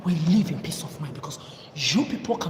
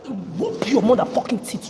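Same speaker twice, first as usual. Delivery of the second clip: angry shout; 4 semitones higher, 7 dB louder than usual. Real, not acted. Angry rant at famous people.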